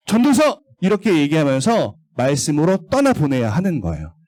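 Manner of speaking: ordinary delivery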